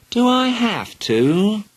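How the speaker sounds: put-on high, slow whining child; whiny. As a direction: drag it out in a whine. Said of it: "asked Helen in a whiny voice".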